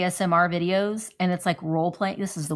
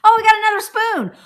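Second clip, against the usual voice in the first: excited; 13 semitones higher, 10 dB louder than usual. Real, not acted. Finding another spoon in kit.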